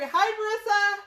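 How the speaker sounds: loud, high greeting; calling out hello. Real, not acted. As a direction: holler hello to a friend watching. Greets a viewer after apologising.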